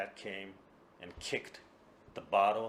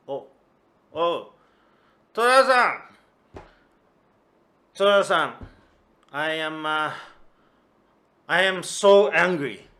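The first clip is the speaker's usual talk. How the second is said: much higher, louder, wailing; drunken wail; 8 semitones higher, 17 dB louder than usual. Acted. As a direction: whine it out drunk and upset. Drunk Kuma wails in anger.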